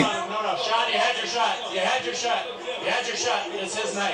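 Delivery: high, loud shout with laughter; laughing shout. Real, not acted. Interviewer shouting down Porter in the ring, laughing.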